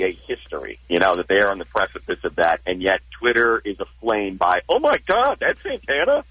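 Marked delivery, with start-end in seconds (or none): 4.7-6.2 high-pitched exclamation of fake shock; mock astonishment. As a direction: imitate a stunned Twitter crowd, voice way up high. Acting out shocked Twitter users.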